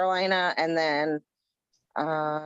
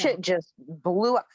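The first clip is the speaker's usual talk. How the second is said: normal talking voice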